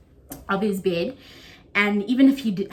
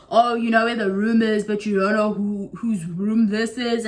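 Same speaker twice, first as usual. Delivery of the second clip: a hint of a snide friend, louder; lightly mock the girl's snide remark; mildly snotty imitation of the friend; mimicking the snooty friend; 4 dB louder than usual. Friend's snide retort, retold.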